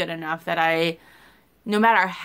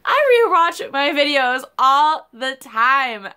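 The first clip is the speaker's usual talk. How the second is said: higher, louder and slow, every word punched; emphatic boast; 6 semitones higher, 6 dB louder, slower than usual. Real, not acted. Vlogger proudly insisting she rewatches her videos, loud. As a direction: declare it proudly.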